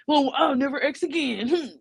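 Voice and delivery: a hammed-up high pouty whine; mock sulking guy. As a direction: act like a man pouting over a no. Mocking the pouting guy.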